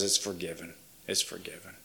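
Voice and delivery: soft, low words of pardon; hushed assurance. Acted. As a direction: say it low and very quietly, as reassurance. Voicing God's gentle assurance of forgiveness.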